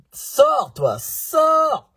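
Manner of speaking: loud, high, drawn-out yell; mock shouted order. Vlogger ordering an intruder out mid-presentation, mock shouted order. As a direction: shout it out, stretching each word high.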